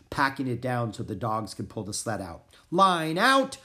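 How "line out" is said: high, loud call, rising at the end; commanding shout. A narrator's reading. Gunner readying his team, commanding shout. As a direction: order the dogs into line. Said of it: "Gunner commanded his team".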